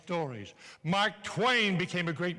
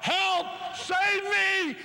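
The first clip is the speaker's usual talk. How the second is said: hollering for help; 12 semitones higher, much slower than usual. Retold or imitated. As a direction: scream for help as the lions charge. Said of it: "He started to run and holler".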